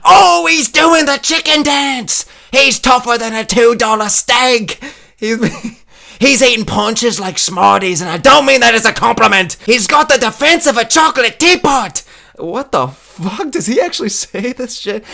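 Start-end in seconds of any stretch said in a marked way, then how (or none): 0.0-12.0 high, loud, drawn-out British commentator; Michael Bisping impression. Comic reading out commentary lines, yelled British impression. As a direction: yell the fight call in a strained British accent. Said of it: "Michael Bisping lines on commentary".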